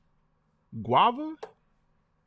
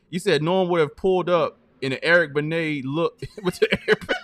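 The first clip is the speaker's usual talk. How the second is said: can barely get it out for laughing; 2 semitones higher, 6 dB louder than usual. Real, not acted. Host cracking up at his cohost's [name] joke, laughing.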